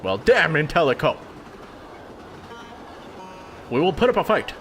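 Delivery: rising into a defiant Southern rallying cry; southern accent. Acted. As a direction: fight for the people, accent turning fierce. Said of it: "I had to stay in that shitty accent".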